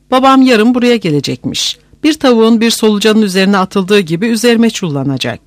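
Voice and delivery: plain reading voice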